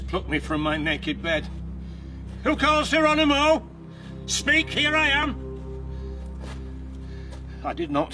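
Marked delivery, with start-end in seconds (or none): 2.4-5.3 calling out. Acted. Roused by outcries.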